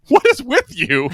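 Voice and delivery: high, loud laughing mock exasperation; exasperated quote. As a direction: toss it off with a laugh, baffled. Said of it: "and he goes".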